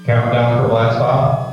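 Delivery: loud, measured launch countdown; countdown announcer. Acted. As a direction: call the countdown like mission control.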